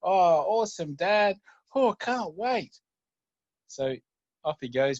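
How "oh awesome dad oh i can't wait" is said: put-on high, slow, thrilled child; can't wait, gushing like a kid; delighted little boy. Son delighted by promise.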